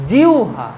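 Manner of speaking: জিহ্বা said as jiu-ha; correct pronunciation demo. Shown as a demonstration. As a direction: glide from i to u in জিহ্বা, then ha. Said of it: "এর উচ্চারণ হচ্ছে". (জিহ্বা-র উচ্চারণ "জিউহা")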